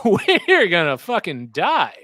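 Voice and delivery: loud, high, drawn-out retold cry; mock doom announcement. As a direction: deliver the punchline big and slow, laughter breaking in. Retold blunt briefing punchline.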